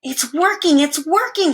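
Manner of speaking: high, loud, thrilled shout; excited shout. Acted. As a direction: cheer it out as the pump works. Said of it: "shouted Arthur".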